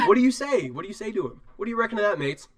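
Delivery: high Australian character voice; Aussie dwarf. Acted. Game master showing the dwarf to players, high Australian accent. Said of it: "that's my Aussie character".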